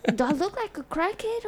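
mock offended at a stranger's offer; offended disbelief. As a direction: sound insulted and amazed. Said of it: "I was like".

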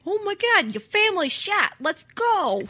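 high, alarmed mock Brooklyn; hammed-up Brooklyn accent. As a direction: exclaim in a bad Brooklyn accent. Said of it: "it's like a Brooklyn country accent".

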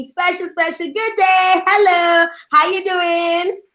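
high, singsong welcome; excited greeting. Real, not acted. Host welcomes guests onto the live show, excited.

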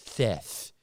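"Seth" with both s and end as th; mock lisp. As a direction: lisp the name "Seth" as "Theth". Mocking a man with a lisp.

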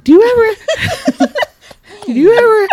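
high giggling question; laughing. Real, not acted. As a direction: giggle through it.

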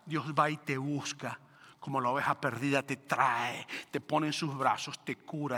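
soft, low, gentle sermon voice; hushed, tender reassurance of God's care; tender preaching. Acted. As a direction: drop down and speak softly, like comforting someone. Tender passage on lost sheep.

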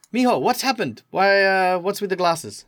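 motherly carer asking what happened; concerned foster worker. Acted. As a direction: press the kid with concern. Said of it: "Elena looks at you and says".

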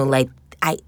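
usual speaking voice

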